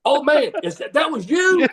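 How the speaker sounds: high, thrilled recognition; excited pop. Retold or imitated. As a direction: retell a sudden burst of delight. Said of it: "I popped".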